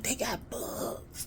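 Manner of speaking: slow, secretive whisper. Retold or imitated. Child telling mom about the bugs, whispering. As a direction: breathe the words out very softly. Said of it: "I'm trying to whisper".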